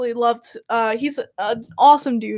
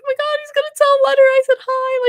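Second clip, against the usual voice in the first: squeaky, much higher, giddy disbelief; overjoyed squeal; faster than usual. Retold or imitated. Fan retelling her thrill at the news, high giddy squeal. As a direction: squeal it like a fan who can't believe it. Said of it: "that moment, I was like".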